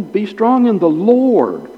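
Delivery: high, exhorting line landing on a long Lord; emphasize Lord, drawn out and falling; stress on Lord. Shown as a demonstration. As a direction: preach it up to a stretched Lord. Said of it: "only emphasize the word you've been given".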